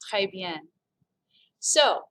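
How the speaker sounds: ordinary talk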